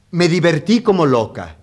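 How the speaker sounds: high, loud Mona Lisa voice; falsetto. A narrator's reading. Mona Lisa gushing to her admirers at the party, falsetto. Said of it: "con su voz de falsete".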